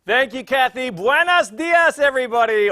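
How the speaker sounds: high, loud, drawn-out hello; big excited greeting. Real, not acted. Emcee hailing the online audience, loud and excited. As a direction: throw a huge welcome to the crowd.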